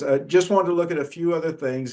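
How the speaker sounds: ordinary talk, nothing marked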